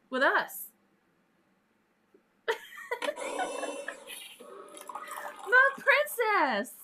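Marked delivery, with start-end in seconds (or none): 2.5-5.4 long, high laugh, soft and airy. Real, not acted. Laughs while teasing viewer. 5.5-6.7 high, drawn-out mock-royal call; playful exclamation. Playfully calls out to viewer.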